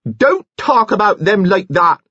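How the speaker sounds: high, loud shout. A narrator's reading. Nurse Kettle shouting at Kitty in defense of the county. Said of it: "Nurse Kettle shouted".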